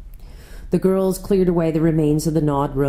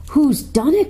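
high, upset demand; distressed girl demanding to know; crying out; 9 semitones higher than usual. A narrator's reading. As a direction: cry out the question, high-pitched. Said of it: "cried Susan".

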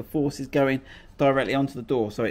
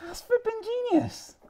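sudden high, excited praise; delighted exclamation. Real, not acted. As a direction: blurt it out like it's a brilliant discovery. Delighted reaction to clever idea.

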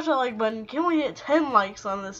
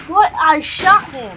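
indignant shout; 5 semitones higher, 10 dB louder, slower than usual. Real, not acted. Indignant at missed kill.

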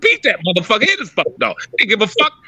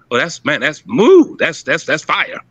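lower, slow, hammed-up awe; mock awed praise; 7 semitones lower than usual. Mocking a flatterer calling a pedigree fire, slow and low. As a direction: drag out the fake amazement.

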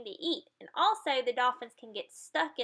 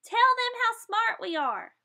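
much higher, louder eager voice; proud dolphin; 12 semitones higher, 6 dB louder than usual. Acted. Dolphin urging the kids, high and eager. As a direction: brag it. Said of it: "The dolphin says".

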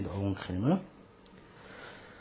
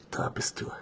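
whispering. Pretend. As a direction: breathe it out, hushed. Finds hiding kitten.

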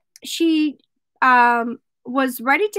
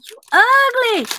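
higher-pitched bird insult; talking parakeet; 7 semitones higher than usual. Acted. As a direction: taunt her like a pet bird. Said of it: "the bird says".

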